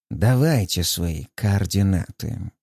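slow drawling voice. Acted. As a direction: draw every word out slowly. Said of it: "медленный, тягучий голос".

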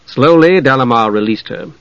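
plain reading voice

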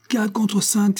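high shouted odds call; bookmakers shouting. A narrator's reading. A bookmaker hollering the odds to the crowd. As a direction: call the odds out across the ring. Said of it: "hurlait-on dans le ring".

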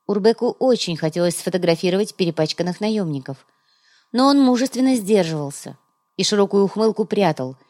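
usual speaking voice

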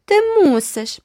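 very high, loud mocking echo; shrill it back to tease; mocking repeat. A narrator's reading. Sister mocking the girl, very high. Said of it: "повторила Джеральдіна".

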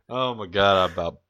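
ordinary talk

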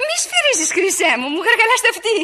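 high, playful squeal with a laugh; ticklish giggling. Acted. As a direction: protest coyly, giggling into the phone. Παιχνιδιάρικη διαμαρτυρία στον εραστή. (παιχνιδιάρικα, με ψιλό γελάκι)

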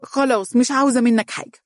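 high-pitched, playful mock sulk; childlike playfulness. A narrator's reading. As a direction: pout like a little girl. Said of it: "تقول بمرح طفولي". (بمرح طفولي)

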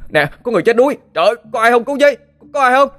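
very high, loud frantic calls; frantic shouting for help on the road; calling for help. A narrator's reading. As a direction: yell down the road for anyone. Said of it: "Vừa đi anh vừa gọi".

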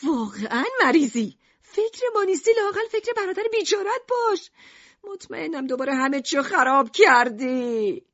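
high-pitched, wailing reproach; exasperated scolding. A narrator's reading. Mother blaming Alan for ruining everything, exasperated. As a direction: pile on the blame in a high voice.

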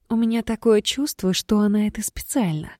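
ordinary delivery, nothing marked

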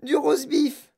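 high-pitched, gushing relish; excited raving. Acted. Girl describing roast beef course in gum, excited. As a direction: gush over every mouthful in a high voice.

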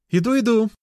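put-on high-pitched girl's voice; girl behind door. Girl answering from behind the door, high call. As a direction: call back in a high girlish voice. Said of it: "раздался голос из-за двери".

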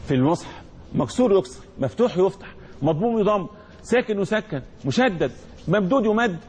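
usual speaking voice

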